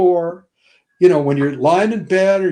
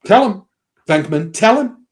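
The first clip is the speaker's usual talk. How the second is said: urging plea; 4 semitones higher, 3 dB louder than usual. Retold or imitated. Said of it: "looked at Bill Murray and go".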